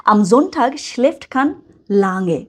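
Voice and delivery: very slow, careful German reading; slow model reading. Shown as a demonstration. Teacher reads the German example slowly for learners. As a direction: read it out slowly so students follow. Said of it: "teacher reads example slowly".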